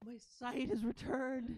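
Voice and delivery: high, wonderstruck creature voice; awed ratling. Acted. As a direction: marvel aloud in a high ratling voice. Ratling marvels at regained sight.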